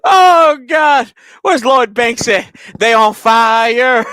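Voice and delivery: high, loud, delighted outburst; can't believe it, shout it out; excited shouting. Real, not acted. Streamer hyping the new jerseys, loud excited shouting.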